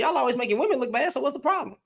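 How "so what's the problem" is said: ordinary delivery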